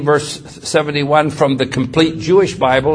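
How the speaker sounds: ordinary talk, nothing marked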